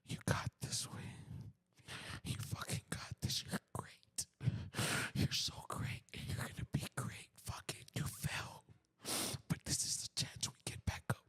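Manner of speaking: hushed, whispered pep talk to himself; breath-only encouragement, very soft; calming self-talk. Retold or imitated. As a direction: whisper it low to steady yourself. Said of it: "And I calmed myself down".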